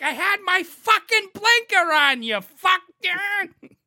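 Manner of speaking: high, loud furious shout; yelling at the driver who beeped; angry yell. Retold or imitated. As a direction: scream it out the car window. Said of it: "I was like".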